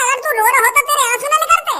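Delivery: usual speaking voice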